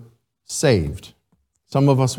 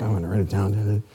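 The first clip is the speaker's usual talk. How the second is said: a touch of God; 7 semitones lower, 3 dB quieter, faster than usual. Retold or imitated. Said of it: "God's in, sitting down, writing".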